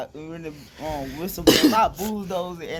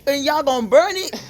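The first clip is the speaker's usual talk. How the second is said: louder, higher outraged question; outraged disbelief; 8 semitones higher, 10 dB louder than usual. Real, not acted. Boy outraged the coach will burn it, loud disbelief. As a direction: shout it in disbelief.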